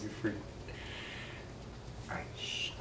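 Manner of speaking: hushed, breath-only whisper. Pretend. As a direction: whisper it as if keeping secret. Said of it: "Why are you whispering?".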